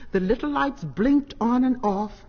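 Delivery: ordinary delivery, nothing marked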